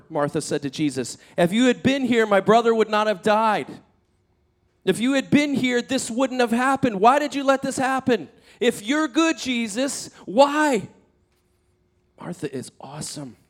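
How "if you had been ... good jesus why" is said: high, loud, pleading challenge; anguished acted protest. Martha confronting Jesus over her brother's death, anguished. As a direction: demand an answer with a raised, impassioned voice.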